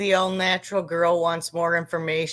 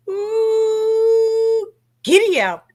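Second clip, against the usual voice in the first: high, loud giddy-up whoop; excited whoop; 7 dB louder, much slower than usual. Real, not acted. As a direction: whoop it out like a cowboy. Exclaiming over diesel prices.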